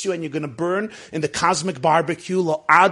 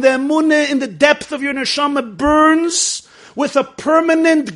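louder, driving sermon voice; full-voiced rabbi's sermon; preaching; 9 semitones higher, 10 dB louder than usual. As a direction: preach it out to the room with force. Preaching to class about doubts.

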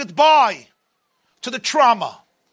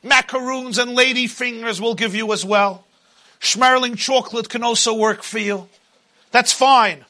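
booming motivational speech; 4 semitones higher than usual. Acted. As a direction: preach it as the taunting tyrant. Pharaoh voice held, preaching.

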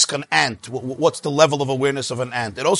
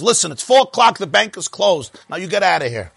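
forceful angry order; 5 semitones higher than usual. Retold or imitated. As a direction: act a manager throwing a customer out. Manager throws out customer in story.

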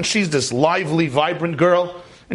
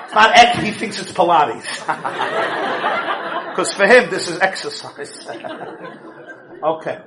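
normal talking voice

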